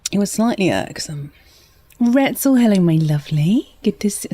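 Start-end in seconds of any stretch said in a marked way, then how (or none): 2.0-4.3 put-on West Country greeting with a rising lilt; West Country accent. Streamer greeting a viewer, West Country accent. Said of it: "sorry, I went all wet, West Country there".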